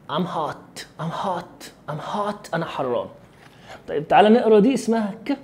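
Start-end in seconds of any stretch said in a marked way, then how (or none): 0.1-2.5 soft American "I'm hot", open "ah" in hot; نطق أمريكي. Shown as a demonstration. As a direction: say "I'm hot" quietly, hot as "haat". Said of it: "الإنجليزي بتاعنا بيتحسن في النطق". (لكنة أمريكية هادئة، hot بألف مفتوحة)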